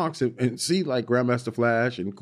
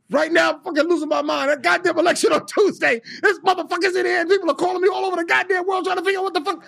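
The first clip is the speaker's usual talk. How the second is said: put-on high, loud gruff old man voice; angry old man; 4 dB louder than usual. [name] mimics Dick Gregory calling back, high and loud. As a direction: bark it like a cranky old man.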